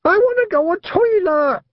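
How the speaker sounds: put-on very high whiny child's scream; screaming toddler. A narrator's reading. Little brother Wentworth to his sister, high whiny scream. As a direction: wail it like a small boy desperate for the toilet. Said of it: "screamed Wentworth".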